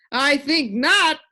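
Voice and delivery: lightly voiced creature; the din, cheerful. A narrator's reading. Din's voice.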